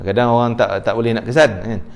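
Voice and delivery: ordinary talk, nothing marked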